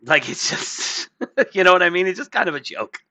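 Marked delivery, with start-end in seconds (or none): none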